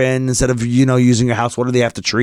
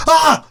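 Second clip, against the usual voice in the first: short, high, loud scream; sudden loud yelp of horror; retold scream; 5 dB louder than usual. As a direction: re-enact a shriek of shock. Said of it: "audibly screamed".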